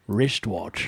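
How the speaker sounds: low Scottish slushy "rishtwatch"; Sean Connery. Shown as a demonstration. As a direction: say it in a mock Scottish star accent. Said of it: "Let's all say it together".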